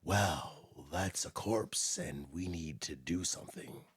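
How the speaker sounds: low, slow, hushed TV cop one-liner; David Caruso. Retold or imitated. Showing a friend his corny CSI opener, low hushed cop impression. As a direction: deliver a cheesy cop line, slow and quiet. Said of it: "he used to open down and go".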